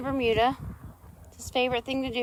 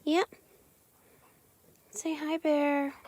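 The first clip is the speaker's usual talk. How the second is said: slow pet coo; 3 semitones higher, much slower than usual. Real, not acted. Coos slowly at her dogs.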